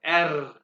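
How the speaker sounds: "एर" with rolled, vibrating R, loud; trilled R. Shown as a demonstration. Teacher repeats German R for pupils, trilled "एर". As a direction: let the tongue vibrate on "एर". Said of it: "यहाँ पे जुबान को vibrate करें".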